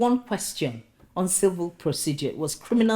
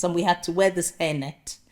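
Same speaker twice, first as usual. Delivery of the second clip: ordinary talk, nothing marked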